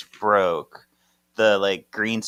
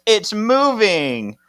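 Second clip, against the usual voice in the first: higher, slow mock yell; retold yell; 11 semitones higher, 5 dB louder, much slower than usual. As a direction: call out "moving" in alarm. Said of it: "somebody yells".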